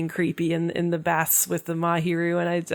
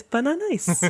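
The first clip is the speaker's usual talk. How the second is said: put-on cute anime girl voice; 8 semitones higher than usual. Banana quoted.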